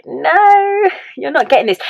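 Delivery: high, stretched mock-whine; exasperated refusal. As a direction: protest in a high voice at someone clueless. Retold exasperation at drunk partner.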